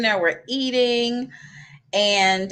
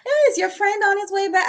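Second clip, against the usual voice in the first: high, sweet waitress voice, gently probing; sugar-sweet question, careful not to be obvious; overly kind waitress; 13 semitones higher than usual. Retold or imitated. Waitress politely asks the stranded diner, high and sweet. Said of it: "trying to be so kind".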